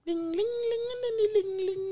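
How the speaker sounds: high squeaky ringing voice; silly falsetto ring; mock phone ring.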